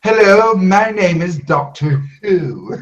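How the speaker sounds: high, slow theatrical proclamation; mock Master proclamation. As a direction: proclaim it grandly, slowly. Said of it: "the female master came out the tardis proclaiming".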